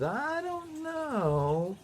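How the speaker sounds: a long, high, drawn-out I don't know; stall, unsure; hesitant doubt. Acted. Adam to Eve, unsure about tasting the fruit, slow high hesitation. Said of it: "And Adam says".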